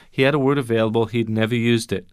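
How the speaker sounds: ordinary talk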